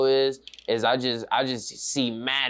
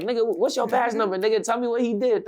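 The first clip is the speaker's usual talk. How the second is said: high-pitched indignant demands; agitated confrontation in a raised pitch; wilding out; 8 semitones higher than usual. Retold or imitated. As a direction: retell badgering the police, worked up and insistent. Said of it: "wilding the police up".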